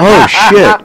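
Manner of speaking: high, loud laughing oh shit; surprised laugh. Real, not acted. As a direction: caught off guard, laughing. Realizes they are on air.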